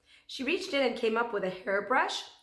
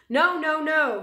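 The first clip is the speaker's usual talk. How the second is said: loud, higher no, no, no; shouting; 7 semitones higher, 9 dB louder, slower than usual. A narrator's reading. Big man rejecting the child, loud shout. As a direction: shout the rejection across the room. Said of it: "he shouted".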